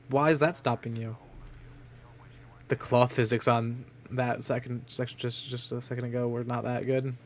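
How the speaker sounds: ordinary talk, nothing marked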